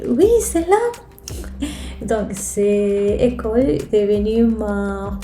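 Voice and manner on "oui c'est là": retold exclamation, pitched way up with a burst of energy; excited confirmation. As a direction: imitate an excited reply, high and louder. Quoting sister's emphatic confirmation.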